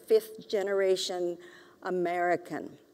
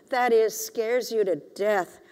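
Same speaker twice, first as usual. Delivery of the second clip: ordinary delivery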